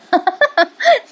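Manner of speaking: high burst of laughter; a quick amused laugh; real laugh. Real, not acted. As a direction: laugh at the joke. Narrator laughing at scene.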